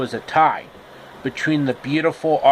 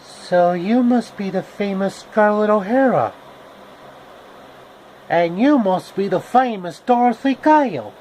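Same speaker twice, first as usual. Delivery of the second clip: higher, lilting Southern belle greeting; Scarlett O'Hara voice; 6 semitones higher than usual. Acted. Scarlett greeting Dorothy at the wrestling show, higher sweet belle voice. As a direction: sweetly size up the famous girl.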